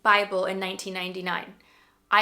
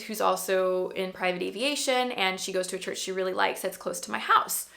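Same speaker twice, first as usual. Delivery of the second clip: ordinary delivery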